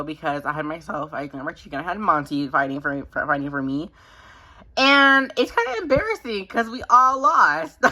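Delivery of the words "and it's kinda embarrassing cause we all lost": laughing. Real, not acted. Embarrassed laugh at losing.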